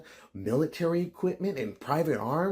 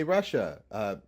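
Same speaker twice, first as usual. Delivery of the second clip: ordinary talk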